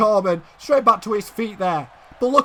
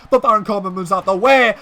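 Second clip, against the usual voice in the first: ordinary delivery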